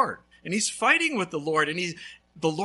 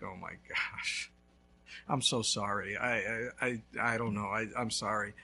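lower, stammering apology; apologetic homeowner; 8 semitones lower, 5 dB quieter than usual. Retold or imitated. Homeowner running out, lower flustered apology. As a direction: stammer out a sorry. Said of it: "He's like".

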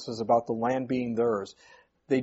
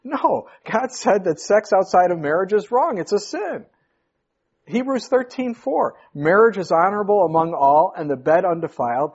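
loud, high fervent sermon; preaching; 5 semitones higher, 3 dB louder than usual. Acted. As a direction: press the point with conviction. Preaching at full force.

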